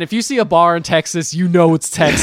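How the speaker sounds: loud, high Texan caricature; bad Texas accent. Telling co-host how you know it's Texas, loud mock Texan accent. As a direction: belt it out in a hammed-up Texas accent. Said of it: "You're doing such bad accents".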